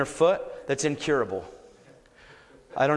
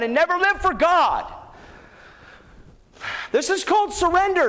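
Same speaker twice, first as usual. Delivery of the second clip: impassioned preaching; 10 semitones higher than usual. Acted. Preacher urging the crowd toward surrender, fervent. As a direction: preach it out to the congregation.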